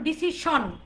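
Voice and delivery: ডিসিশন as di-si-SHON, hard sh and full o; common mispronunciation. Shown as a demonstration. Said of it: "যেমন আমরা বলি". (ডিসিশন ভুল উচ্চারণে, শেষে স্পষ্ট শন)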